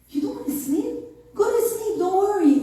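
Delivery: high, coaxing quote of the aide. Retold or imitated. Aide urging her to sleep.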